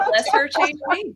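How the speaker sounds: high, laughing call; laughing mantra. Acted. Host echoing the guest's mantra, laughing and calling it high. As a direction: shout it out through laughter. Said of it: "this sounds like a prayer".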